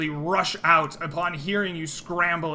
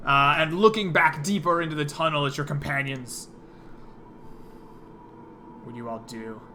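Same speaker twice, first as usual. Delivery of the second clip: ordinary delivery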